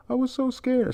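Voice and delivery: put-on squeaky high falsetto girl line; high crying girl voice. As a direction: pitch way up like a tearful girl.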